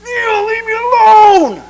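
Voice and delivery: high, loud, slow wailing plea; exasperated God. Retold or imitated. Preacher voicing an exasperated God, high loud wail. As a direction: whine it out, fed up and begging. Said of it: "he's like".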